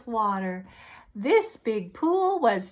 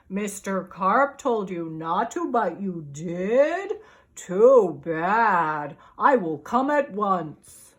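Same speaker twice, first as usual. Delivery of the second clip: policeman; 4 semitones lower, slower than usual. Acted. Policeman's voice on phone.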